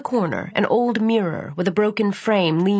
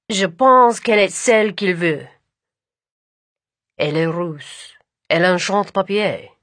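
native French speech; French accent; 3 dB louder than usual. Acted. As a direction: speak it as a Frenchman. Brothers speak French.

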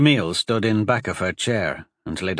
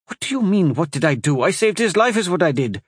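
high, indignant retort; defensive; 8 semitones higher, 3 dB louder than usual. Acted. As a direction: snap back in defense. Wife argues back.

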